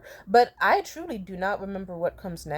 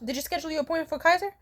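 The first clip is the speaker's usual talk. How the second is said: nagging mom; 8 semitones higher, faster than usual. Retold or imitated. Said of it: "my mom was like".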